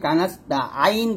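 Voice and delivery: কানাস্তা আইন with an open "aa-in" ending; wrong A-vowel on আইন; common mistake. Shown as a demonstration. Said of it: "মারাত্মক একটা ভুল".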